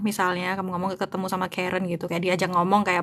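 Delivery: usual speaking voice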